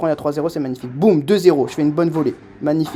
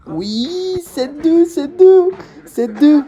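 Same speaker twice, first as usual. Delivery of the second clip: much higher and louder, triumphant; excited cheering; 11 semitones higher, 7 dB louder, much slower than usual. Real, not acted. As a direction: cheer the score out loud. Celebrates leading seven two. (cri de joie triomphant)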